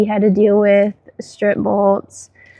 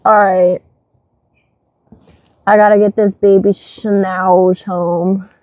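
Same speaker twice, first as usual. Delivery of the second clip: normal talking voice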